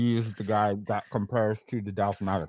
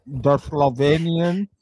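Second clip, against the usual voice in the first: mouth full; 6 semitones higher, 4 dB louder than usual. Real, not acted. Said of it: "He sounds like the guy from Wolf on Wall Street".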